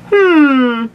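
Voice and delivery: high, loud drawn-out hum; old man's doubtful hum; suspicious hmm. A narrator's reading. Grandpa examines the teeth suspiciously.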